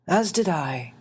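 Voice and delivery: put-on a small old man asking kindly; little winged man. Xavier's voice.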